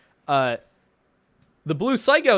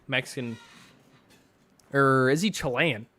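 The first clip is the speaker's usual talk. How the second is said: usual speaking voice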